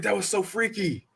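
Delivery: high, spooked exclamation; retold freak-out. Recalling the witch riding him, high freaked-out voice. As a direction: say it high, thoroughly weirded out.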